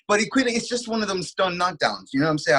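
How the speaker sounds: normal talking voice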